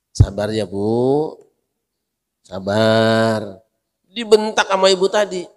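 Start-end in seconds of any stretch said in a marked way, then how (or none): 0.1-3.6 gentle comfort. Acted. Rasul comforting grieving mother.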